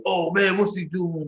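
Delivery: lightly hammed-up dude-bro outrage; frat boy. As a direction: imitate a riled-up frat bro. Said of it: "like, frat boy".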